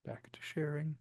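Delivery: muttered under the breath to himself; a quiet whisper while clicking back to the screen share; whispered aside. Real, not acted. Whispering to himself while sharing the screen.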